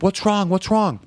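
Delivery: high, urgent imitation of a mother; re-enacted motherly panic; worried mom. Voices worried mom bursting in.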